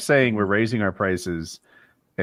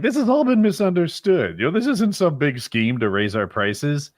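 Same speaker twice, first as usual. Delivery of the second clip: mock corporate; 7 semitones higher than usual. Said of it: "Wendy's was like".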